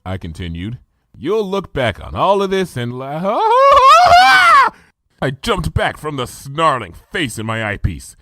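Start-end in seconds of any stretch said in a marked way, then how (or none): 3.2-4.7 very high, loud, wild roar-laugh; shrieking howl; monstrous laugh. A narrator's reading. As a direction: startle with a beastly snarl. Snarling face in eyepiece.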